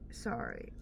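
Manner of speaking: ordinary talk, nothing marked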